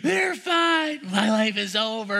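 imitating a crushed child, pitched high; hammed-up despairing kid. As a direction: play the boy mourning the loss. Boy laments while leaving.

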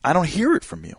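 acting out a hurt, doubting wife; incredulous wife. Wife doubting her husband's love, high and incredulous. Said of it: "And she's saying".

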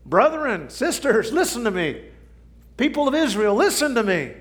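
high, loud prophet's call. Acted. Jeremiah calling out to the people of Israel. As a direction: summon the people to listen.

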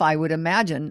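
usual speaking voice

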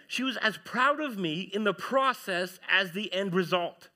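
usual speaking voice

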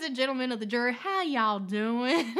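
a Southern lawyer greeting the jury. Retold or imitated. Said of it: "in the South we go".

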